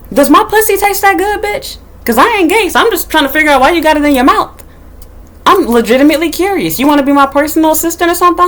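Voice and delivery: high-pitched furious ranting; angry rant. Real, not acted. Ranting at a hater commenter.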